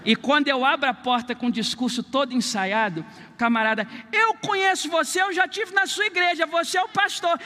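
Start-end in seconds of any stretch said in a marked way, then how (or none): 4.1-7.4 raised-pitch, eager recognition; a fan delighted to meet the pastor; delivery man recognising the pastor, high and eager; excited delivery man. Retold or imitated.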